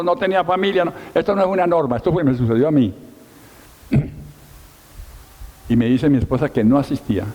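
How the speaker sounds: ordinary delivery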